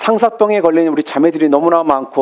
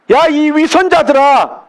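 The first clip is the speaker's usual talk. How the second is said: Jesus scolding the Pharisees, raised rebuke; rebuking call; 7 semitones higher, slower than usual. Retold or imitated. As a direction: call out 야 sharply, then scold the hypocrites. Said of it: "그들을 향해 부르셨다는 거예요".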